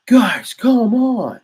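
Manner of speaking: high, slow, drawn-out whine of exasperation; exasperated British plea. Retold or imitated. The British pleading with the colonists after the tea is dumped, a high, slow exasperated whine. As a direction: whine it like a put-upon Briton.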